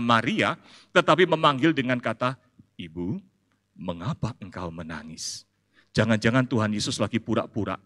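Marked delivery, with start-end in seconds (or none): 2.7-5.5 soft, low, tender asking; a quiet, kind voice for Jesus; gentle question. Acted. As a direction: coax her softly like a comforter. Jesus addresses Maria gently.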